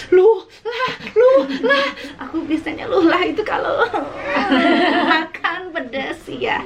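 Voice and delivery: high, giggling through the words; laughing. Real, not acted. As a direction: laugh while you explain. Laughing while describing heat.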